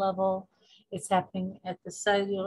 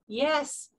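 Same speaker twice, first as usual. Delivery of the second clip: higher, thrilled thanks; excited gratitude; 4 semitones higher, much slower than usual. Acted. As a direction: act out waking up to a wish come true. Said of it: "you'd be excited".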